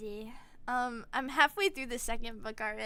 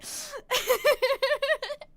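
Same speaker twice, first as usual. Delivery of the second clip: high, loud giggling fit; real burst of laughter; laughing; 11 semitones higher, 6 dB louder than usual. Real, not acted. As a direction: crack up at your own act. Cracks up at own acting.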